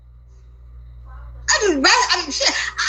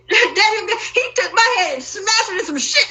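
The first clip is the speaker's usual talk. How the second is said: ordinary talk, nothing marked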